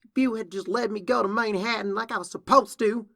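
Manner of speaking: high, loud protest of a wronged sister; angry blaming. Acted. Sister blames Applejack over missing Manhattan, angry protest. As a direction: raise the voice and pitch in protest. Younger sister argues back.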